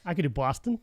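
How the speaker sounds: lightly attempted Boston accent. Brit challenged by co-host, attempted Boston accent. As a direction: try a Boston accent on demand. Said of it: "Let's hear it".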